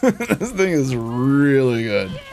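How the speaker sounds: REALLY stretched out slowly; drawn-out emphasis. Real, not acted. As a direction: lean long on "really". Repeats praise with drawn-out stress.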